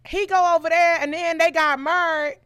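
put-on high, loud North Carolina accent. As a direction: imitate North Carolina relatives, drawing out the words. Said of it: "North Carolina people do".

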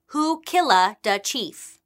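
slow, mock Italian accent, no real yell; bad Italian accent. Crowd taunting Italian prisoners outside the police station, bad Italian accent. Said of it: "yelling at them in bad accents".

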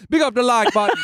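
high-pitched mock patois impression; [name]'s new voice. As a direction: mimic a co-host's silly accent, high. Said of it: "[name]'s new voice".

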